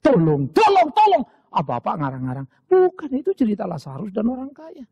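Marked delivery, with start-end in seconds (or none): 0.0-1.2 high, loud desperate yell for help; panicked shouting for help; cry for help. Acted. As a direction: scream it like a damned soul begging. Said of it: "baru teriak".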